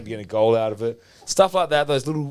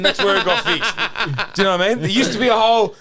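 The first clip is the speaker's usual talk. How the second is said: laughing while telling; 10 semitones higher, 4 dB louder than usual. Real, not acted. Laughing at his own retort.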